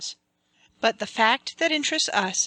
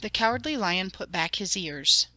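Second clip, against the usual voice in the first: soft, quieter storytelling; hushed story narration; 4 dB quieter than usual. A narrator's reading. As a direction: draw the listener into the dark woods.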